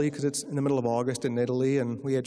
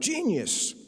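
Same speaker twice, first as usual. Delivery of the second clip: quick high leap in a mock scream; mock yell; 11 semitones higher than usual. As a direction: re-enact the chairman's yell on one word. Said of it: "the guy who yelled out the word".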